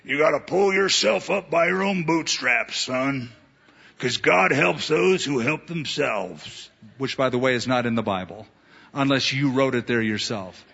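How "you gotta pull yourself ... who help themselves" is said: mock self-help voice. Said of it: "the humanist message is".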